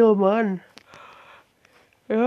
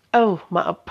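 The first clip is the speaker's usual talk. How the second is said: normal talking voice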